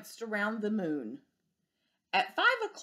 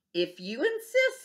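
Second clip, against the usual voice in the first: stag beetle; 5 semitones higher, 4 dB louder than usual. Acted. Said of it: "said the stag beetle".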